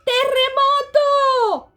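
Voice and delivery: loud, high, slow cry of alarm; a panic-stricken dog yelling about an earthquake; panicked shout. A narrator's reading. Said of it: "shouted Poquito Tito, panic-stricken".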